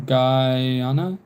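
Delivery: "Guyana" drawn out as guy-AH-na, ending in a question; unsure pronunciation. Real, not acted. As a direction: try "Guyana" slowly, asking if it is right. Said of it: "or am I saying it wrong".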